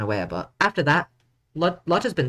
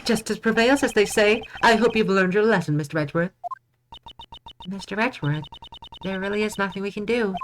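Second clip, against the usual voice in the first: raised, lofty judge voice; higher judge voice; 6 semitones higher than usual. Acted. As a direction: play the judge, a bit pompous. Voices judge character in game.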